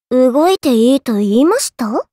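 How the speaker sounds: loom over them with a low question; low icy rebuke; cold threat. Acted. Threatening enemies.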